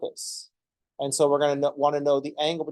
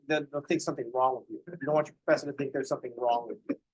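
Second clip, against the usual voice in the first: usual speaking voice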